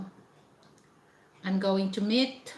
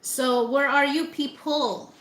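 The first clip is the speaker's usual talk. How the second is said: calling out; 5 semitones higher, 3 dB louder than usual. Real, not acted. Calling out to absent viewers.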